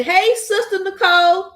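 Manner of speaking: high, loud sing-song greeting; high bright greeting cue; cheerful prompting. Real, not acted.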